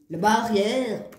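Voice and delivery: mock Franche-Comté accent, vowels drawn out. Tarot reader catches her regional accent, hammed-up Franche-Comté accent. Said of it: "s'il parle comme ça en Franche-Comté".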